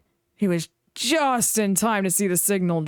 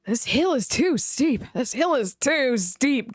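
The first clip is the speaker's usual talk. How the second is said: slow, high grumbling; grumbling coaches; 6 semitones higher, slower than usual. A narrator's reading. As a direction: grumble while climbing slowly. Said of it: "grumbled the coaches".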